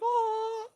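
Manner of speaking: high, loud pitying "aw"; exaggerated high-pitched aww; mock sympathetic aw. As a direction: imitate someone cooing in sympathy. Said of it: "she's just like".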